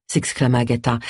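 ordinary delivery, nothing marked

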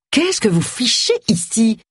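high, drawn-out outraged question; indignant exclamation. A narrator's reading. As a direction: exclaim slowly and high at intruders. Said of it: "s'exclama-t-elle". (exclamation indignée, aiguë et traînante)

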